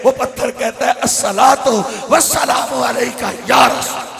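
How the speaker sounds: loud, ringing sermon delivery; preaching at full force. Acted. Preacher rousing the congregation, loud impassioned preaching. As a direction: proclaim it to the whole mosque.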